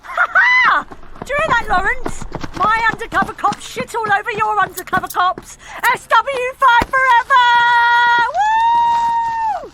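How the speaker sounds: high, loud victory crowing; gloating triumph. Acted. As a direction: crow over your rival.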